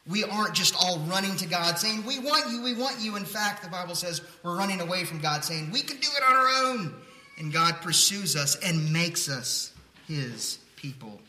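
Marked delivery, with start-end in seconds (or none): 2.0-3.2 high, eager pleading in jest; mock eager. Imitates eager crowd running to God. 5.7-7.0 high, loud, rushed protest; mock defiant. Imitates people running from God.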